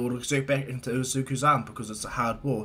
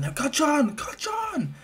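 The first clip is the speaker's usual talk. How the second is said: very high, strained calls without much volume; alarmed calling out; 13 semitones higher, much slower than usual. Acted. Boy searching for his friend, high alarmed calls. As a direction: call the name, climbing higher each time.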